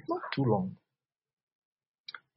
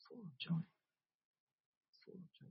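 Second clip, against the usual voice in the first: fading repetition; 5 semitones higher, 17 dB quieter than usual. Real, not acted. Repeating phrase fading away.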